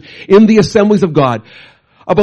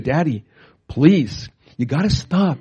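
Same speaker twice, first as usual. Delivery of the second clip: softer, pleading daughter; quiet plea; 6 dB quieter, slower than usual. Retold or imitated. Daughter begging her sick father to stop, soft. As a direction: beg him gently to rest.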